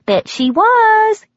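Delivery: waitress deciding the mother was jealous; knowing waitress. Acted. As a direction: answer yourself with gossipy certainty. Waitress answers her own question.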